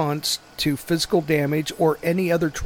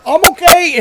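high, loud squeaky cartoon voice; goofy dazed reassurance; 12 semitones higher, 11 dB louder than usual. Driver after the crash, high loud goofy voice. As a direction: chirp it out like a dazed goof. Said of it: "rear ends a car".

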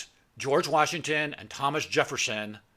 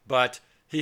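ordinary delivery

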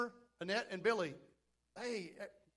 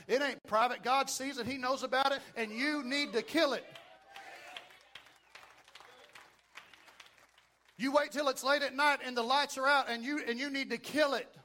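rising preacher's fervor; preaching; 3 semitones higher, 4 dB louder than usual. Acted. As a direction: preach it with building force. Preaching at full force.

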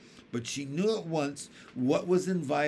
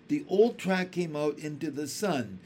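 plain reading voice

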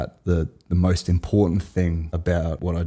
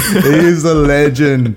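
excited; 8 semitones higher, 7 dB louder, slower than usual. Real, not acted. Affirming enthusiastically.

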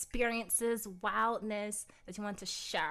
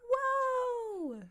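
hammed-up wonder; mock amazed exclamation; 11 semitones higher, much slower than usual. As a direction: play up the surprise of finding a kindred accent. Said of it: "And you're like".

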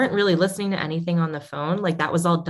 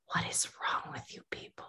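unvoiced whisper, much softer; 15 dB quieter than usual. Real, not acted. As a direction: hush it like a secret aside. Repeats line as whispered aside.

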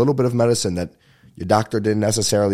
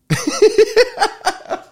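high giggle; 4 dB louder than usual. Real, not acted. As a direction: burst of high, tapering laughter. Laughs after own singing.

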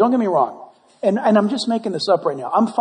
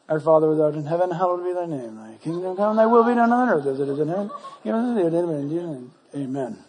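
fast, softer mumbled rote prayer; rote rattled prayer; 6 semitones lower, 6 dB quieter, faster than usual. Pretend. Mocking a believer rushing the Lord's Prayer, fast and mumbled. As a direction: gabble it like a chore.

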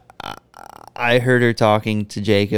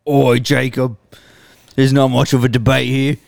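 Birmingham gangster voice drifting Australian; Arthur Shelby impression; 3 semitones higher, 3 dB louder than usual. Co-host does his Arthur impression for a cold open, gangster accent. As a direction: growl it like a Peaky Blinders heavy. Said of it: "I want you to do the impression".

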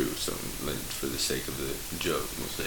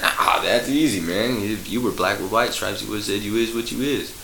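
louder, higher punchline; punchline delivery; 4 semitones higher, 7 dB louder than usual. Acted. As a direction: land the joke with a grin.

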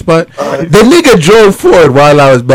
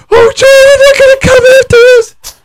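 much higher, hammed-up panicky friend; imitating scared Shan. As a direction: squeak it out like a frightened passenger. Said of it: "Shan was so scared".

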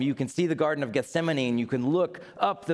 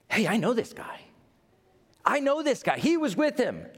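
put-on accusing slave girl; 11 semitones higher, faster than usual. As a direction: point him out shrilly to the crowd. Slave girl accuses Peter loudly.

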